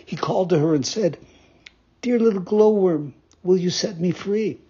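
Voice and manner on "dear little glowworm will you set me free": pleading puppet. Acted.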